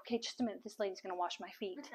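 low, very soft, dismissing onlookers; quiet aside. Retold or imitated. Older woman shoos people away, quiet aside. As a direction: imitate a lady discreetly shooing people off.

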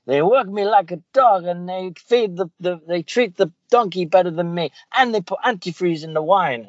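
high-pitched cartoon kid complaining; Bart. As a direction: plead your case in a put-on high voice. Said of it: "he ran over to the police officer and was like".

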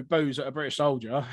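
normal talking voice

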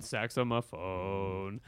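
very slow, low, stretched delivery; drawn-out word. Acted. Draws the word out jokingly.

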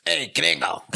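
hammed-up Mexican accent that slips into Italian; mock Italian-Mexican accent. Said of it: "Like that".

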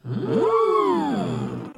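high thoughtful hum; crowd hmm. Acted. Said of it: "said the crowd of crayfish".

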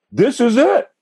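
put-on like a seventeen-year-old who just found his calling; thrilled discovery. Teen thrilled on film set.

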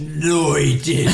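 emphatic contradiction. Real, not acted. Daughter insists her dad had no accent, loud contradiction. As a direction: shout down the other person's claim.

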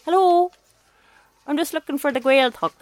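put-on high sing-song Donegal accent. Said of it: "you can do a Donegal accent".

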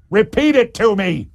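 loud, high scream of an order. Retold or imitated. Deputy mayor demanding the mayor repeat the name, screaming. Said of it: "screamed at Giuliani".

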